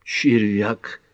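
a short, mildly scornful snort of a word; snorted insult. A narrator's reading. Startled Pat snorts at Ellery, mildly scornful. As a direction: snort the name at him lightly. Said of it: "фыркнула Пэт".

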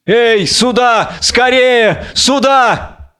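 high, drawn-out calls for help; shouting. A narrator's reading. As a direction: call urgently for someone to come. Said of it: "Кричит".

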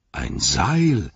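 a touch of excitement on a sudden idea; aufgeregter Ausruf. A narrator's reading. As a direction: exclaim with sudden relief. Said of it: "rief Sam vor Aufregung und Erleichterung". (erleichtert und leicht aufgeregt)

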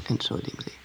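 tonlos geflüsterte Entschuldigung; flüstere die Entschuldigung, ganz ohne Stimme; geflüstert. Acted. Lawyer apologizes after laughing. (Anwältin entschuldigt sich flüsternd beim Häftling)

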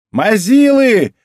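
high, loud, drawn-out jeer; shout. A narrator's reading. Казуки jeers at the snowball throwers, shouting. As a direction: yell it back at bad shooters. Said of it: "крикнул, обернувшись Казуки".

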